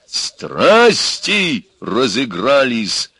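громко, высоко и очень протяжно; греметь, растягивая каждое слово; громовой рёв. A narrator's reading. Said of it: "загремел он хором". (раскатисто прогреметь)

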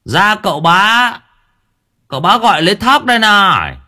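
high, loud swaggering call at the door; swaggering servants calling. A narrator's reading. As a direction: arrogant servants shouting for the tenant, high and loud. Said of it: "Bọn gia nhân khệnh khạng".

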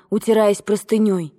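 ordinary delivery, nothing marked

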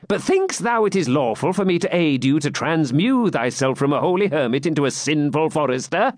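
high, lightly voiced jester; teasing jester. Jester questioning the friar's change of clothes, teasing. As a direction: tease the holy man with a high-pitched question.

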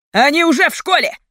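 high, loud, panicked cry; hysterical. A narrator's reading. Captor warning the leader, hysterical tone. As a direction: shout it hysterically. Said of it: "истеричным тоном ответил другой".

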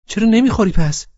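put-on high, loud girlfriend voice; girl's voice. A narrator's reading. Laleh scolding him for being late, high voice.

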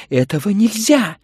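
very high, loud wail; plaintive cry. Acted. Mrs Bowles forbids the poetry reading, wailing. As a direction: cry out in helpless objection. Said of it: "жалобно возопила миссис Баулс".

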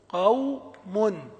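قوم stretched very slowly, waw held silent; drawn out example. Shown as a demonstration. Teacher models a word with a sakin waw, very slow. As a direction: draw out قوم as qawm. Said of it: "الواو الساكنة تبقى ما تعل".

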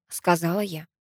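ordinary delivery

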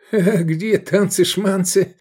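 high, slow teasing question; playful surprise. A narrator's reading. Drunk pal joins the mockery, playfully surprised. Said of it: "игриво удивился он".

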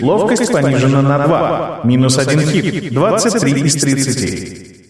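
slow, detached interface readout; system message voice. A narrator's reading. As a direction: read it like a game interface.

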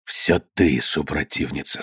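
low, soft, out-of-breath reproach; winded. A narrator's reading. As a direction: accuse her while catching your breath. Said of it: "тяжело переводя дух". (тихо, переводя дух)

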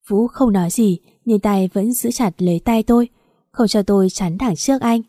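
ordinary delivery, nothing marked

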